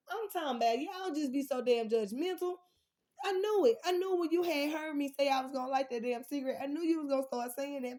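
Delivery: loud and high, calling out the judgers; riled-up call-out. Real, not acted. Calling out the man in the back, loud and riled up.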